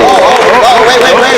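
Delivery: loud, high wait wait wait; loud urgent call. Real, not acted. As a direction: shout to stop the sale. Late bidder stops the sale.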